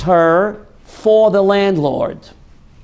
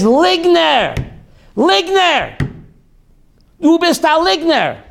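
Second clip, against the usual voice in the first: retold furious yell; 8 semitones higher than usual. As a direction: shout liar at her, outraged. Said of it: "He begins to scream and shake".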